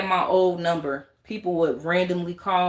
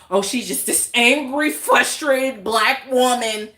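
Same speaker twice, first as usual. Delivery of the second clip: a touch louder, slowed mocking voice; mocking critics; 4 semitones higher, 5 dB louder than usual. Mimicking people who judge her, mock scorn. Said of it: "people just feeling like".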